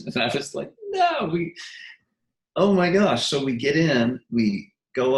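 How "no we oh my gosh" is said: high-pitched disbelieving outburst; stunned excitement. Retold or imitated. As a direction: retell it with a high, amazed burst. Recalls being let in, stunned.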